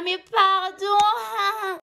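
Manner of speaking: very drawn-out, high indignant cry; hammed-up "excuse me" wail; acted outrage. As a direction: stretch it out in mock disbelief. Acts outraged outburst in cinema.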